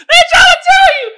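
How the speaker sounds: laughing shout. Real, not acted. Ranting to viewers about interracial dating, loud high shout. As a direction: yell it, wound up.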